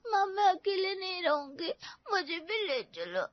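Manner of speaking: put-on crying child begging to come along; tearful pleading. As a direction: plead through tears not to be left alone. Child begs mother not to leave. (रोते हुए गिड़गिड़ाकर)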